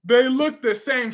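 usual speaking voice

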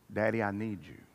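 soft, low, needy appeal; hushed plea. Acted. Prodigal son running to his father, hushed plea. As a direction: plead quietly to a father.

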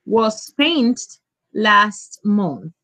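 each word stretched out; teacher repeating the right answer; very slow model answer. Shown as a demonstration. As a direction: say it very slowly for the class. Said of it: "Very good".

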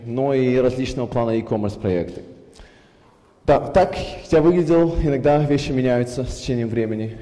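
ordinary talk

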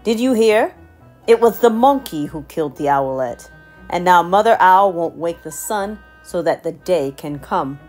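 loud announcement. Acted. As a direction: announce it to the gathering. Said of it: "The king said".

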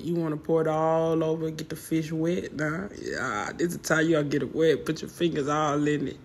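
ordinary talk

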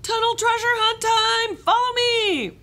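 very high, loud call to the group. A narrator's reading. Camp leader calling the kids to the treasure hunt, loud high call. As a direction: shout across the camp to gather everyone. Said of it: "Lizzie called".

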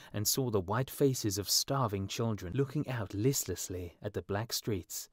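usual speaking voice

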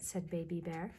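plain reading voice

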